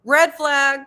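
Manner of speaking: high, loud alarm call; calling out a red flag; alarmed warning. Real, not acted. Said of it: "warning comment".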